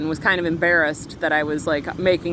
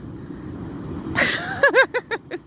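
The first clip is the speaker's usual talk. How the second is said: sudden high-pitched burst of laughter; bright high giggle. Real, not acted. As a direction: squeal with laughter at the memory. Laughing at own absurd story.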